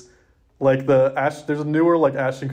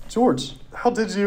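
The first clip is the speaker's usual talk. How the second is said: surprised; 8 semitones higher than usual. Acted. Sabrina's surprised line in script.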